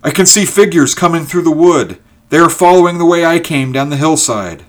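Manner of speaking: high, loud, excited shout; joyful cry. A narrator's reading. Ulrich spotting rescuers coming through the wood, joyful cry. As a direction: cry out the sighting with rising excitement. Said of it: "Ulrich gave a joyful cry".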